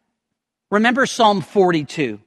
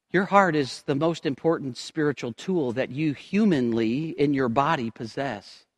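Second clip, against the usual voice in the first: normal talking voice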